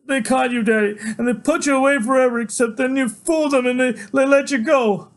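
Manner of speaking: put-on high, loud little kid, slowing at the end; a small child blurting to his father; young child's voice. Boy telling his daddy, high and loud.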